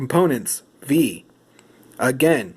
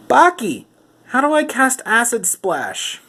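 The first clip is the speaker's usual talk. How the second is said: calling for help; 10 semitones higher than usual. Acted. Host calls on silent Baki about a spell, high appeal for help. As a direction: call out the name high, then ask.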